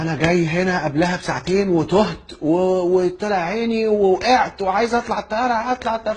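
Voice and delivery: high-pitched, worked-up rant without real shouting; agitated passenger reenacted; retold shouting complaint. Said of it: "أنت ليه بتزعق".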